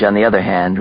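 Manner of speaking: plain reading voice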